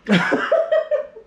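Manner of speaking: high-pitched burst of laughter. Real, not acted. Reactor laughing at the lyrics, amused.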